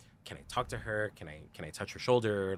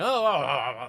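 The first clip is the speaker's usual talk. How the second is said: sudden, louder, higher surprised oh; startled oh oh oh; 5 semitones higher, 11 dB louder, slower than usual. Retold or imitated. Told by friends to tell his wife, a startled loud oh. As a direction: caught completely off guard, blurting it out. Caught off guard by friends' question.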